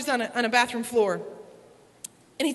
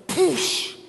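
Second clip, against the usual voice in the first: imitated squish; 3 semitones higher than usual. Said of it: "it goes".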